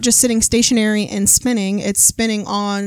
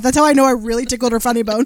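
normal talking voice

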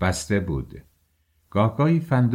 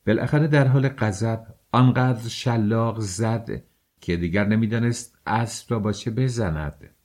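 ordinary delivery, nothing marked

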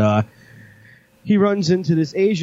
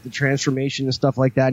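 ordinary talk, nothing marked

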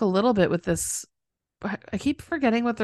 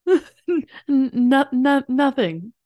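mimicking a higher, stuttering denial; flustered stammer; 5 semitones higher, much slower than usual. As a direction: stumble on it higher, covering up a scare. Mimics his flustered cover-up answer.

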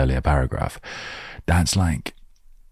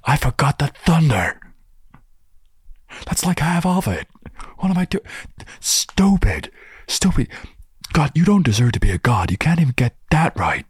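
much higher, exasperated self-scolding god voice; a clumsy god berating himself; frustrated god; 12 semitones higher than usual. Retold or imitated. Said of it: "He's like".

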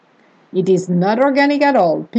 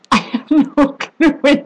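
real laughter through the words; laughing; 5 semitones higher than usual. Real, not acted. Baffled by a cheesy line, laughing. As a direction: let a laugh break into it.

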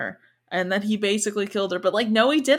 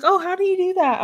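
imitating an eager question, high-pitched and louder; curious girl; 10 semitones higher, 4 dB louder, faster than usual. Retelling the girl asking how it's done, high and curious. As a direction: put on a high, inquisitive voice. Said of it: "she was like".

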